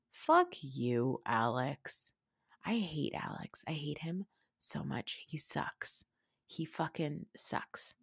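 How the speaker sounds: whispered, seething; hateful whisper. Real, not acted. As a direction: hiss out your hatred under your breath. Venting hatred about Alex.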